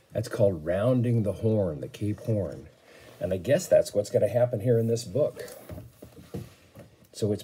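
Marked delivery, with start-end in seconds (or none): none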